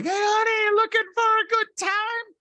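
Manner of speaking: high-pitched mock German-accented call; imitating a German woman's come-on. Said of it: "but in a German accent".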